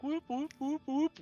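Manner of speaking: alarm whoop. Retold or imitated. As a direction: imitate a blaring robot alarm. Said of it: "alarm bell on their head that's like".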